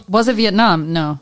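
ordinary talk

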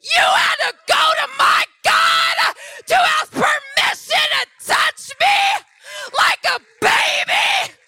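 loud, high scornful shout; mocking shouted rebuke. Mocking the spirit as a little baby, scornful shouting. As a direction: belittle him at full volume. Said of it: "I said".

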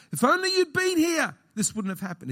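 high, aching cry of disappointment; hammed-up complaint. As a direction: wail it like a let-down believer. Contrast with words of hope.

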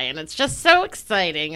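ordinary delivery

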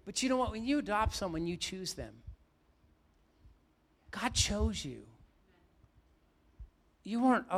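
loud, high, rising sermon voice; full-force preaching. Acted. Pastor preaching to his congregation, full force.

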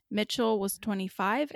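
ordinary delivery

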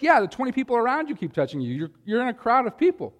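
high, mocking retort; mock them back in a high sarcastic voice; sarcastic reply. Preacher voices a sarcastic bystander, high and mocking. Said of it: "It's like".

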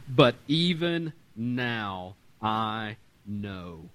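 "But even now I know" drawn out very slowly; slow firm faith. Retold or imitated. Martha declaring her faith to Jesus, very slow and deliberate. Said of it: "She says".

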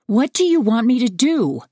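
high, exasperated demand. A narrator's reading. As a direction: throw it out as a high-pitched challenge. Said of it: "I demand".

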